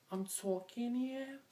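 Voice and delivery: slow, drawn-out Jersey tough talk; New Jersey attitude, slow and drawn out; Jersey accent. Shown as a demonstration. Vlogger doing Jersey tough guy, slow Jersey accent. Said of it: "talk in a Jersey accent".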